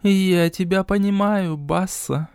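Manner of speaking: gently. A narrator's reading. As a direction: speak unexpectedly gently. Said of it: "неожиданно мягко сказала богиня".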